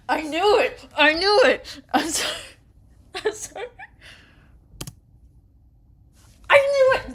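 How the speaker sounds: very high, loud triumphant yell; ecstatic shout. Real, not acted. Gamer cheering as the favourite character returns, ecstatic shouting. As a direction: scream with joy.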